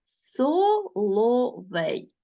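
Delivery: very slow, each syllable spaced apart. Shown as a demonstration. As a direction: spell out Безпідставний slowly by syllables. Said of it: "teacher splits word into syllables". (повільно, по складах)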